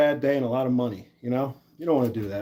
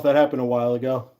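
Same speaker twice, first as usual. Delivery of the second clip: ordinary delivery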